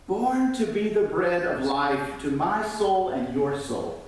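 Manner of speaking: usual speaking voice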